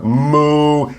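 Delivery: throaty moo. Shown as a demonstration. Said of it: "from the throat".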